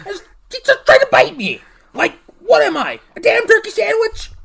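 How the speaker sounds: high-pitched, incredulous outburst; indignant disbelief. Acted. George tells Velma of the subway biter, indignant. George retells being attacked.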